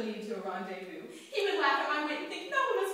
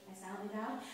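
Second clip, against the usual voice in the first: ordinary delivery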